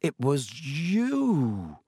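slow, drawn-out realisation; slowly. A narrator's reading. Harry confronting Dobby as it dawns on him, slowly. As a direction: say it slowly, stretching the last word. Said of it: "he said slowly".

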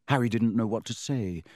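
ordinary delivery

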